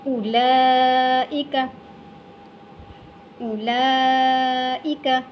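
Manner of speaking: उलाइका with short u and very long laa; silent waw. Shown as a demonstration. Teacher models correct reading, elongated recitation. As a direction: recite उलाइका slowly, holding only the laa. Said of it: "वाव नहीं पढ़ा जाएगा".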